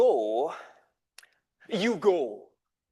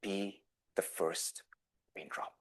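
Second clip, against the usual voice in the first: very soft, low distant murmur; a remembered voice fading; echoing memory; 10 semitones lower, 12 dB quieter than usual. Retold or imitated. Mother's words echoing in the son's mind, very soft. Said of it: "mom's voice echoes".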